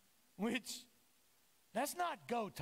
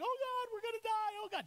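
mock panic; 12 semitones higher than usual. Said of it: "They're like".

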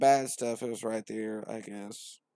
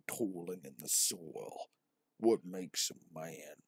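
low, slow, hammed-up epic voice; mock dramatic narrator; 6 semitones lower, 7 dB quieter, slower than usual. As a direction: intone it like a movie trailer.

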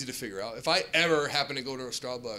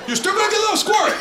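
squeaky; 8 dB louder, faster than usual. Acted. Mocking Subway workers.

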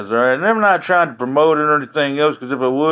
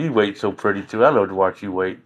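flirty sweet talk; 9 semitones lower, 6 dB quieter than usual. Real, not acted. Sweet-talking his wife on stream.

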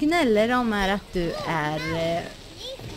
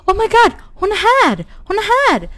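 high, loud burst of excitement; excited shriek; 11 semitones higher, 7 dB louder than usual. Real, not acted. Gamer spotting the character at last, shrieking excited. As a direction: squeal that she finally arrived. Sudden arrival of awaited character.

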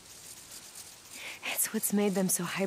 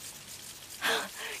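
sharp gasp; 9 dB quieter than usual. Acted. Sudden startle.

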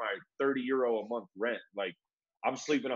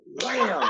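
slap sound effect; 9 semitones higher, 7 dB louder than usual. Retold or imitated.

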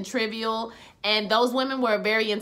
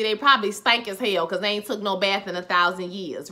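usual speaking voice

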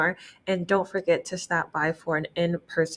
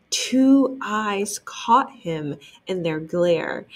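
usual speaking voice

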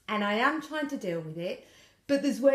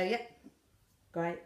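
minimal one-word replies; curt withdrawn answer; 5 semitones lower, 6 dB quieter than usual. Acted. Woman answering her partner's questions, curt and withdrawn. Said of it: "I'll give, like, one or two word answers".

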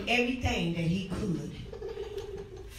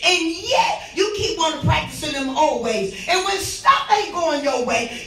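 hollering revival preacher; 5 semitones higher, 6 dB louder than usual. Real, not acted. Preaching as God's voice, shouting.

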